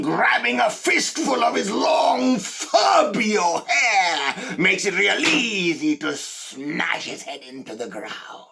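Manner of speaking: loud, high yell building, then easing at the end; frenzied shouting. Acted. Killer relives attacking the store thief, frenzied high shout. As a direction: relive the attack at a screaming pitch.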